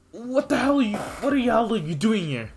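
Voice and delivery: a touch of shocked anger; alarmed demand. Acted.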